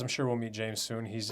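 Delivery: ordinary delivery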